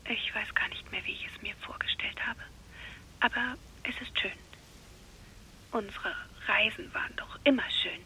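hushed whisper so the father sleeps on. Acted. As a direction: whisper down the phone, careful not to wake anyone. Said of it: "Wieso sprichst du so leise".

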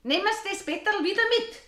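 high, fast and sharp, ordering him out; angry landlady. Acted. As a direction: Frau Schmidt demanding he take the bed back, angrily. Landlady chases off Eder.

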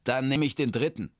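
a hint of a flustered man; lightly voiced, hesitant man; Hermann. Hermann stumbles over his long new name, lightly voiced.

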